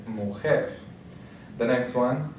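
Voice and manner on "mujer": "mujer" with the weight on final jer; stress on last syllable. Shown as a demonstration. Teacher repeats the example word for learners, "mujer" stressed on jer. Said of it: "stress is going to be on the last syllable".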